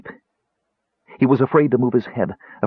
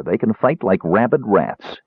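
ordinary delivery

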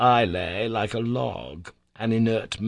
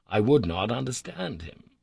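ordinary delivery

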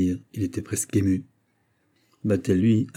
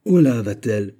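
a lord calling his steward, commanding and a bit louder; masterly voice; 2 semitones higher, 6 dB louder than usual. Acted. Noble hails his steward in a master's voice. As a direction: call out to him as his master would. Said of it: "d'une voix de maître".

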